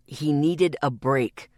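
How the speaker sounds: low, slow through clenched teeth. A narrator's reading. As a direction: bite it out. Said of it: "he bit out between clenched teeth".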